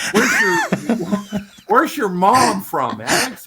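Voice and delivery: high, laughing American drawl; slowed laid-back American, chuckling; Owen Wilson impression.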